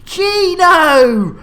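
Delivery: cheerful shout to a viewer; excited call. Real, not acted. Greeting a viewer.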